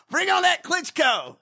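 high, loud, laughing call-out; mock challenge shout. Host jokingly calling out a boxer, shouting. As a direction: shout it like a fired-up fighter.

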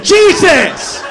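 normal talking voice

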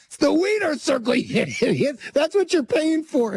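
high scolding dad voice; mocking dad. Dad explaining the Wiener Circle to son, high mock scolding. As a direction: play the dad calling his son an idiot. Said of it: "And he goes".

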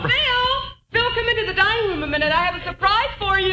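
high singing-out to her husband; calling out. Acted. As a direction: call him in from another room, pitched high. Wife calls husband from room.

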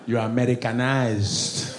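put-on slow Nigerian-accented verdict. Nigerian telling the comic off, slow Nigerian accent. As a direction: pronounce it slowly in a Nigerian accent.

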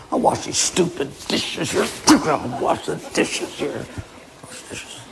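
soft muttered complaint fading to a whisper; grumbling under breath. Acted. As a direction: grumble to yourself so she can't hear.

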